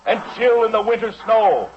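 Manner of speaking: slow, high, grand speech delivery; solemn oration. Acted. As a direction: proclaim it like a founding prophecy. Solemn patriotic oration opening.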